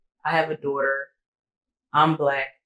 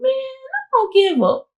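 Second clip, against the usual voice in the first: exasperated dismissal; 10 semitones higher than usual. Retold or imitated. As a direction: wave him off for good. Refusing to call back.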